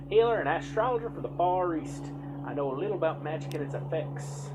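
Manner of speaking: a hint of a kindly village healer warning the hero; healer woman's voice. Healer woman continues speaking.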